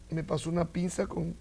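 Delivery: ordinary talk, nothing marked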